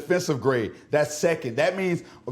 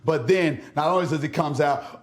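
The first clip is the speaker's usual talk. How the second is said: ordinary delivery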